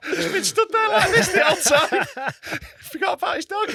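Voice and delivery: high shouted laugh, crying with laughter. Real, not acted. Comic cracking up at the forgetful puppet man, laughing. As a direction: laugh helplessly through it.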